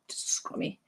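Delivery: whispered aside under the breath; a quick, voiceless throwaway whisper. Real, not acted. Medium whispering an aside during the reading, voiceless.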